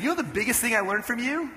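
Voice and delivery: high-pitched, eager question; lifted, keen quoted question; eager retold question. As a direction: ask it like you're sharing a big reveal.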